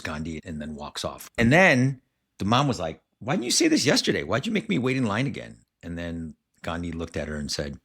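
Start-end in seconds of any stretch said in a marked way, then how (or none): 3.2-5.6 high, quick indignant complaint; exasperated mom. Retold or imitated. Mom confronting Gandhi after a second long wait, exasperated and high. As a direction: play the mom fed up at waiting twice.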